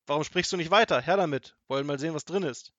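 snatch the bottle; loud robber. A narrator's reading. Robber snaps. (ungeduldiger Räuber, laut)

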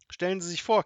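a touch of delighted giggle; giggled. A narrator's reading. Grandmother on her first bicycle ride, mildly giggly. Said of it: "kicherte sie".

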